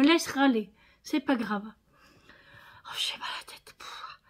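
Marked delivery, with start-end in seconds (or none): 2.8-4.2 real tired whisper, very soft; weary whisper. Real, not acted. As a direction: breathe it out, drained. Tired vlogger complains of headache. (chuchotement las, très doux)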